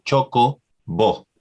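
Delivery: slow, clear "Choco bo" with Spanish vowels; clear Spanish accent. Retold or imitated. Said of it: "she says that very clearly".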